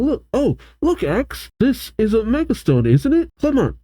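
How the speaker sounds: high-pitched, eager character voice; Trevor. Acted. Trevor showing X the Megastone, high character voice. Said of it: "Trevor points to the camera".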